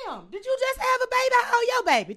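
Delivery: high, fast, startled question; amazed, rushing the words out; incredulous guard. Retold or imitated. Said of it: "She say".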